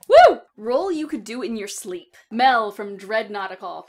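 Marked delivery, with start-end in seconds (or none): 0.1-0.4 big high whoop of joy; loud woo. Real, not acted. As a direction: cheer it out at full blast. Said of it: "I can be loud and crazy".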